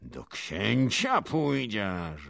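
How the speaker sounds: slow, loud, high taunt; menacing drawn-out cry; princess calling out. A narrator's reading. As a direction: draw the words out as a shouted challenge. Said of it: "wołała kniachinia".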